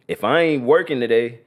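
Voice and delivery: ordinary talk, nothing marked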